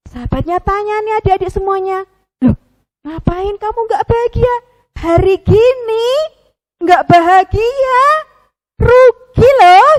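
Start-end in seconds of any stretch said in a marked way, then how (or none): 2.4-9.9 high, teasing little friend voice, rising to loud; coaxing friend puppet. Acted. Pupi coaxes the gloomy penguin, high and building louder. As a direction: cheer him up, getting louder.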